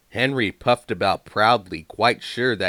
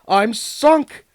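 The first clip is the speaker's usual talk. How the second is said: dismayed engine voice; 9 dB louder than usual. A narrator's reading.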